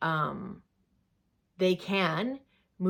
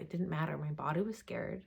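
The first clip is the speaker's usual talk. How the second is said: ordinary talk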